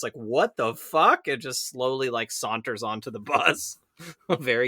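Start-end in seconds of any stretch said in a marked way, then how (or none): 0.1-1.3 bewildered. Retold or imitated. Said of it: "he has this look on his face".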